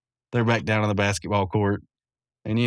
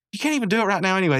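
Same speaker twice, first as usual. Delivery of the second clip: put-on rushed, high kid voice; fast high whine; 9 semitones higher, much faster than usual. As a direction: gabble it out fast. Rigby rushing the argument.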